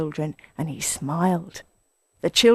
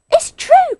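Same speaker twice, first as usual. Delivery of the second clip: put-on very high, loud, squeaky young voices; little fox cubs crying out; small foxes; 10 dB louder than usual. A narrator's reading. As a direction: pipe up excitedly. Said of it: "cried the small foxes".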